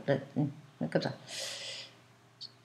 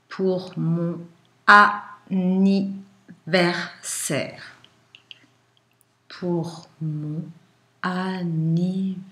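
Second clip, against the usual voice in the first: slow, word-by-word dictation; dictating; much slower than usual. Shown as a demonstration. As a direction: dictate slowly to a class.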